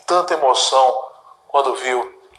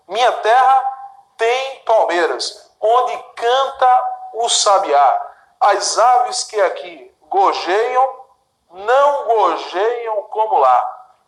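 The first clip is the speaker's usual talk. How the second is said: higher, slow, each verb stressed; counting the verbs; 5 semitones higher, slower than usual. Shown as a demonstration. Teacher counting verbs in the stanza, slow and stressed. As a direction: lean on each verb so students count them.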